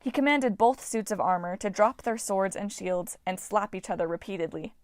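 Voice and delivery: plain reading voice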